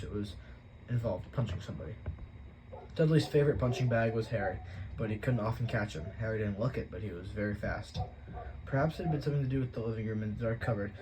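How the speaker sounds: usual speaking voice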